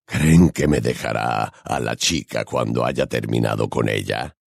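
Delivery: very low, oily creature voice; syrupy, deep predatory voice; honeyed werewolf. A narrator's reading. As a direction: drop deep and purr the question with menace. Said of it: "preguntó Greyback con voz melosa".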